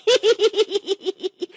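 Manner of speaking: high cartoon cackle; greedy high cackle; gleeful cackle. Impatient for the fish.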